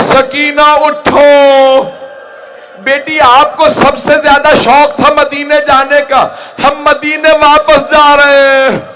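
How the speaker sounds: high, loud wail with long-held endings; weeping cry. Acted. Mother clinging to her daughter's grave, weeping cry. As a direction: call out to a lost child in full-voiced lament. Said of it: "रो रो के कह रही थी".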